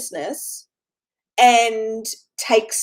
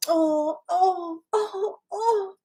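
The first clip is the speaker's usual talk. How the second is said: high, melting "aww" over something adorable; imitated tender swooning, climbing higher; gushing loving coos; 8 semitones higher, much slower than usual. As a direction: coo with an exaggerated swell of love. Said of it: "all that loving energy".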